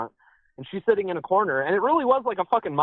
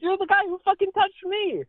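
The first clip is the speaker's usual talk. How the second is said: much higher, pointed accusing voice; mock accusing shout; 13 semitones higher than usual. Calling after the man who touched him, high mock accusation. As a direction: jab the words after him as he walks off. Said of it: "I was like".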